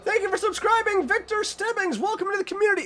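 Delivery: very high sing-song thank you; cutesy welcome. Acted. Streamer welcoming a new subscriber, very high cutesy voice. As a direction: greet the new subscriber in a squeaky cute voice.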